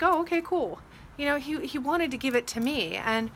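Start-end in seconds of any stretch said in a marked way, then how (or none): 0.0-0.8 mock casual grab. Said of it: "he wasn't like".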